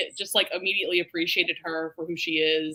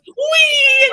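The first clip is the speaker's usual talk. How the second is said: very high, loud squeal; silly squeal; 14 dB louder than usual. Retold or imitated. Friend acting out Bruiser's exit, high loud squeal. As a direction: squeal like a giddy grown man.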